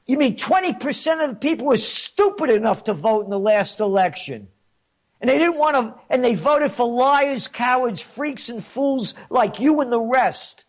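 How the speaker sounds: high, rising angry retort, a touch performed; retold anger. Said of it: "his voice rising in anger".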